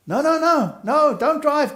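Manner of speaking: high, loud, urgent cry to stop; frantic shout. Retold or imitated. Stabbed labourer begs the farmer not to drive off, frantic shout.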